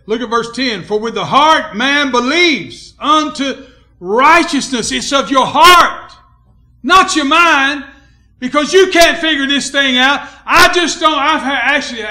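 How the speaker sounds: loud, high, emphatic pulpit delivery; full-force preaching. Real, not acted. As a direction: drive it home to the congregation.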